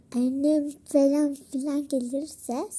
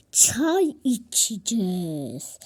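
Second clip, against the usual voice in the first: whisper; 7 semitones lower, slower than usual. Pretend. Playing house, low aside.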